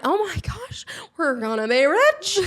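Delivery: high, laughing retold glee; mock excitement. Creator reacting to tiny brand deal, mock excitement. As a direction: gush as if a fortune just landed, hammed-up.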